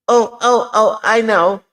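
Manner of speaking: high squeaky little frog, loud and eager; excited frog voice. Acted. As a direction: pop up like a tiny frog who knows the answer.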